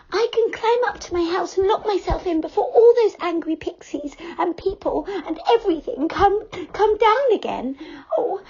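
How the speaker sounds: high light fairy voice; Silky anxious to flee the angry pixies; Silky's voice. A narrator's reading. Silky character voice.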